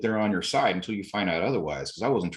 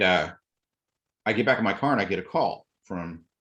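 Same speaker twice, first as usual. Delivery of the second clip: ordinary delivery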